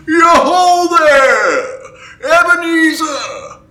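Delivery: rich, fat, jovial call, high and loud. Acted. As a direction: boom it out like a merry old master. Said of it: "comfortable, oily, rich, fat, jovial voice".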